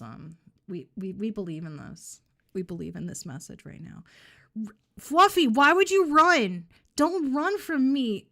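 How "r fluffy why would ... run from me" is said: high, loud and slow, drawn-out threat; slow, high-pitched mock-villain shout at the runaway; mock menacing yell. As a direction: holler after her like a horror villain. Yelling after the fleeing pet.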